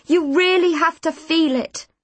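forceful coaching; urging. A narrator's reading. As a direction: press her to mean it. Urging her to mean it.

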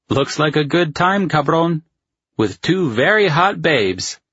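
loud, high-pitched ribbing from a buddy; teasing friend. A narrator's reading. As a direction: needle your pal, pitched well up. Friend teases over scandal photo.

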